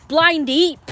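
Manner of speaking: Blind Eep yelled high and loud; loud taunt. Real, not acted. Taunting the dog Eep for not seeing it, loud yell. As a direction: shout the insult at the dog. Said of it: "that stupid dog, you know, pissed me off".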